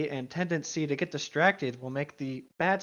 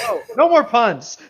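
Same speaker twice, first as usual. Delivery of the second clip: loud, drawn-out groan of protest; exasperated loud no more puns; 6 semitones higher, 11 dB louder than usual. Real, not acted. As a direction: beg them to stop the puns.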